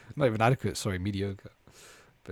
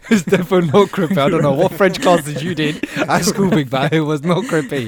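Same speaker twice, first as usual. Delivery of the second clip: loud, high, laughing protest; laughing disbelief; 6 semitones higher, 10 dB louder than usual. Real, not acted. Ribbing a mate's French classes, loud laughing disbelief. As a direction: ridicule it through your laughter.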